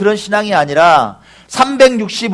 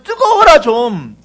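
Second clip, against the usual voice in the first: acted urgent rebuke, pitch riding high; exasperated exhortation; 7 semitones higher, 4 dB louder than usual. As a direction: chide them as if quoting a frustrated master. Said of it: "예수님 말씀하시잖아요".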